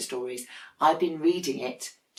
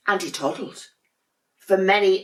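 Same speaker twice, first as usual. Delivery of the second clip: usual speaking voice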